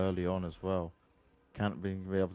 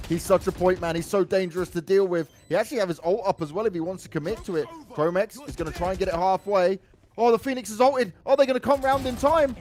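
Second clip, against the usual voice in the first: rising, louder climax call; esports caster; 10 semitones higher, 8 dB louder than usual. Acted. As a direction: explode on the final play.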